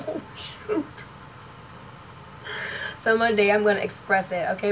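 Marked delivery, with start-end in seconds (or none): 0.1-0.9 whispered dismay. Retold or imitated. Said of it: "I was like".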